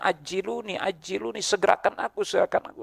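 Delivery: high, softened cry of a pleading corpse; mock crying out. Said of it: "dia teriak-teriak tuh".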